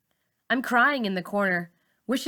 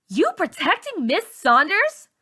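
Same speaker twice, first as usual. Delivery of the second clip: slow, loud, much higher sneer; angry accusation; 10 semitones higher, 7 dB louder than usual. A narrator's reading. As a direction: drag it out accusingly. Char accuses Malika.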